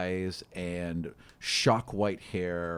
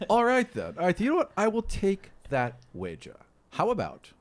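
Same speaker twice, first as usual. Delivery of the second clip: eager challenge; 11 semitones higher, 4 dB louder than usual. Acted. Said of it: "He's like".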